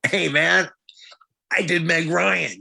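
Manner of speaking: mock boast. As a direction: blurt it out as a joking brag. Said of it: "come right home and say".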